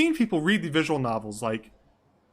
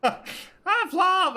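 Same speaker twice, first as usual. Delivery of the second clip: very high, loud falsetto greeting; high-pitched mock character; 5 dB louder than usual. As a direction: squeal it in a silly put-on voice. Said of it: "do every voice like this".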